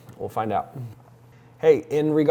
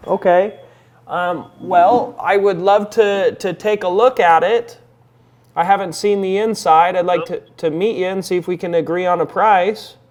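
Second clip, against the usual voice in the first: raised, higher phone voice; loud phone voice; 7 semitones higher, 10 dB louder than usual. Real, not acted. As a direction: project up for a weak line.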